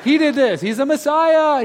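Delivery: high, loud and slow proclamation; excited shouting. Acted. Healed people shouting to everyone, high and loud. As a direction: shout the news to the crowd.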